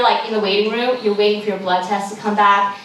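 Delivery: ordinary talk, nothing marked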